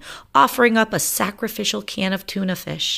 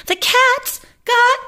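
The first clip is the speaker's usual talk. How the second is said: drawn-out squeaky shriek; 6 dB louder, much slower than usual. Acted. Rosie grabbed.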